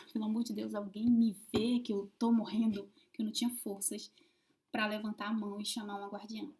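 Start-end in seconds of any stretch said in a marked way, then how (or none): 0.1-2.9 desperate plea. Retold or imitated. Woman retelling her silent plea to the guardians, desperate. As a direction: beg like you're dying. Pleading for help, near death.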